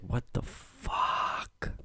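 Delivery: slow, breathless whispered disbelief; stunned whisper. Retold or imitated. Student hearing he's called to the office, whispered disbelief. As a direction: barely voice it, dragged out in shock. Quoting his own shock.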